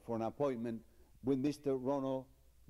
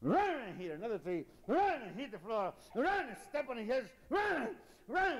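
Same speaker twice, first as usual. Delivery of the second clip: rising higher and higher with each crash; wild build-up; 11 semitones higher than usual. Acted. Teller acting out the elephant beating up the lion, climbing ever higher. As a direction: pile on the mayhem faster and higher.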